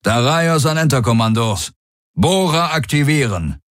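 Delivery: high-pitched commander issuing orders; warlord. A narrator's reading. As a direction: give a military order over the comm. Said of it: "sagte der Feldherr".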